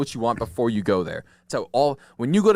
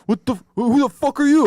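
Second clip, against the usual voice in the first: high, loud outraged challenge; retold angry yell; 10 semitones higher, 5 dB louder than usual. Customer to the stranger who challenged him, retold angry yell. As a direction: re-enact a yelled confrontation, pitched way up. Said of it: "me and this guy are yelling at each other".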